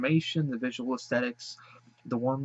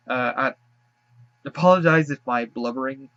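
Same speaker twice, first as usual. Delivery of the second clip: crying; 8 dB louder than usual. Real, not acted. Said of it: "I apologize again for crying".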